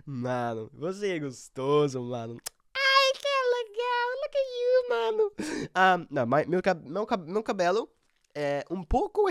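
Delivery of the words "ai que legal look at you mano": high gushing squeal. Pretend. Vlogger gushing at his own camera image in a squeal. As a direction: gush like a fan meeting a star.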